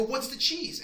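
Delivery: asking dad about the cheese; eager question. Retold or imitated. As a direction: ask it with bright eagerness. His own question retold.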